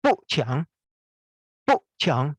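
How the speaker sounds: 不强 spoken slowly and clearly, no added K sound; 不强 dengan lafal jelas tanpa bunyi K; Chinese syllable demo. Shown as a demonstration. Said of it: "Ingat jangan tambah K ya, umpama gini". (不强 diucapkan pelan dan jelas tanpa tambahan bunyi K)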